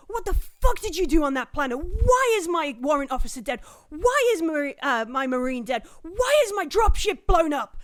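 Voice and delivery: loud, rising furious rant that cracks toward the end; furious tirade. Acted. Commanding officer tearing into Zim over the mission, furious shouting. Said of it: "the absolute tirade".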